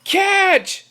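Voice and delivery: high, urgent call; yell. Acted. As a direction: shout it up to the window. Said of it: "yelled Pete".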